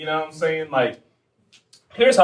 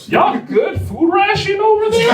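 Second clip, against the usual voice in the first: very high, slow, louder question; incredulous; 5 dB louder than usual. Acted. Comedian asking his friend about food, incredulous. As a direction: draw it out in squeaky mock disbelief.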